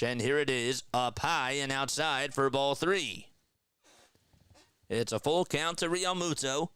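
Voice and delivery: lively baseball play-by-play call. Acted. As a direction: call the action like a broadcaster. Streamer calls the game.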